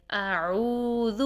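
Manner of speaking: اعوذ with the و held long for two counts; اعوذ, long madd on the و; long two-count vowel. Shown as a demonstration. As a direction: stretch اعوذ slowly, vowel drawn out. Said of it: "دو second کے لیے یہاں پر ہم لمبا کریں گے".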